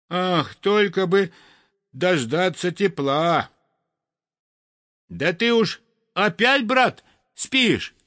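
high, chirpy little bird; lively sparrow voice. Acted. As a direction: chatter like a cold sparrow. (высокий бойкий голос воробья)

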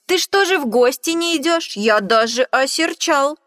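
loud, high teasing reproach; mock scolding shout. Mouse loudly teasing the fox for not visiting. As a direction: scold her playfully.

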